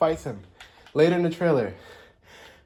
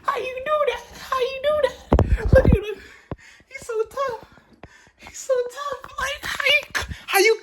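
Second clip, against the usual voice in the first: much higher, crying-laughing squeal of awe; fangirl squeal. Real, not acted. Fan squealing at the trailer villain, tearful excited squeal. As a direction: squeal with delight, near tears.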